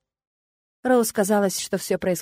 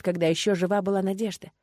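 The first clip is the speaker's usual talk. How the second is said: usual speaking voice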